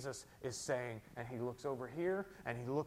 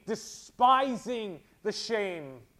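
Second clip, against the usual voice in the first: slow, louder, in anguish; pained cry; 7 semitones higher, 9 dB louder, much slower than usual. Acted. Jesus on the cross, slow and pained. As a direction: drag the words out as if in pain. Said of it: "He cries out in pain".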